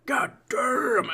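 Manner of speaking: loud, high yawned curse; yawning. Real, not acted. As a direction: yawn right through the words, loudly. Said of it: "I'm yawning so much".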